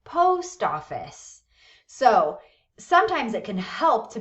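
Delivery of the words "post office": drawn-out "Post office", weight on the first word; stress on POST. Shown as a demonstration. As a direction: stretch and stress "Post", then let "office" fall. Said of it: "first syllable of a compound noun is stressed".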